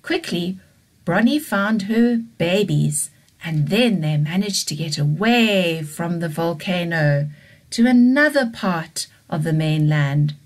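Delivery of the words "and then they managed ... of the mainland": ordinary delivery, nothing marked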